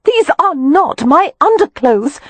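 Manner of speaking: slow, high, exasperated woman's correction. A narrator's reading. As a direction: set him straight, fed up and slow. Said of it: "Wynne's exasperated voice".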